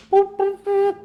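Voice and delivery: high, loud imitated intercom boops; intercom beeps. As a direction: beep like an intercom chime. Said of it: "like an intercom kind of system".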